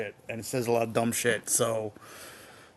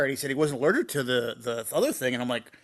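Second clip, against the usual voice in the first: usual speaking voice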